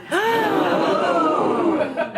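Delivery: a big theatrical gasp, high and loud; mock gasp. Comic gasp at her own secret agent reveal.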